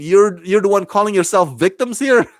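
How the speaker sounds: high-pitched incredulous retort; half-hearted retold yell; mock outraged scream. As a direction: imitate her disbelief, pitch climbing. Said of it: "screams at him".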